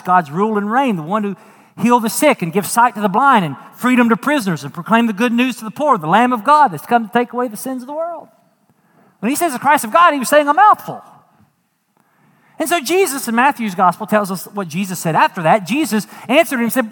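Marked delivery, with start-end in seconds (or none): none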